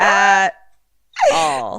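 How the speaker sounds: loud tearful outburst, word by word; exasperated wail. Real, not acted. Listener didn't see the prank coming, wailing loudly.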